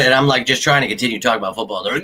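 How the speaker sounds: ordinary talk, nothing marked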